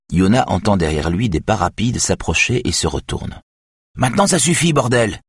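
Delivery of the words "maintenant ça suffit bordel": crier plus haut, plus fort, exaspérée; éclater de colère; cri furieux. A narrator's reading. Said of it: "crie Saga Bauer". (Saga Bauer chasse Yona de son enquête, en criant)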